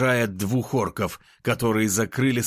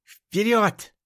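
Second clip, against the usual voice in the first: shouted command; 12 semitones higher than usual. A narrator's reading. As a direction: yell it to charge forward. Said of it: "выкрикнула она".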